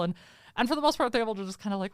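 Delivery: ordinary talk, nothing marked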